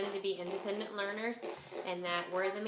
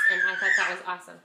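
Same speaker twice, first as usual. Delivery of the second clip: laughing; 11 dB louder, much faster than usual. Real, not acted. Laughing after the quote she liked.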